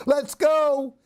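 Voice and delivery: bright excited call to the pets. Acted. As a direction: call the pets to come along. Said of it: "she called to Fluff and Frisky".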